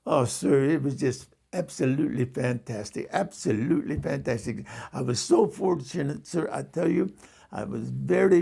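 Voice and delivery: imitated East Indian cabbie telling a tale; East Indian accent. Cab driver tells passenger about his sister, in an East Indian accent. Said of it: "I'm gonna attempt to do his accent".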